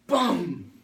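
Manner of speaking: loud, sudden acted outburst; explosive bang. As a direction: blast it out like temper exploding. Imitates God exploding in anger. (크게 터뜨리듯)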